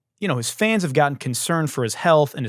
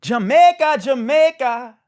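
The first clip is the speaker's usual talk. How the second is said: high Jamaican shout; 9 dB louder, slower than usual. Pretend. Mocking the sunset clip with a high loud call.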